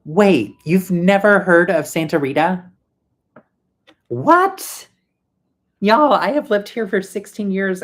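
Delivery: stunned what, pitch jumping up; incredulous surprise. Real, not acted. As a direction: gasp at the chat in disbelief. Viewer hasn't heard of restaurant.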